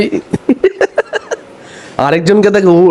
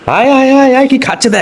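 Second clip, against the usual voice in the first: calling out; 9 semitones higher than usual. Real, not acted. Calling the dog over.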